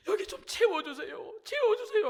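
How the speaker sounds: high, loud plea, rising on the repeat; re-enacted tearful begging. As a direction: beg as if crying in prayer. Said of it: "막 울면서". (울며 간절히 애원하는 재연)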